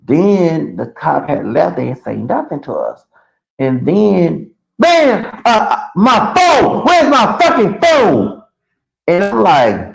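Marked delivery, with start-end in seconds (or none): 4.8-8.4 high, frantic yelling about the phone; panicked outburst. Retold or imitated. Roommate frantically yelling about his missing phone, panicked. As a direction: shout it like your phone just vanished.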